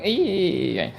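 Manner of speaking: high creaky screech; dying pterodactyl screech. Real, not acted. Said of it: "I sound like a dying pterodactyl".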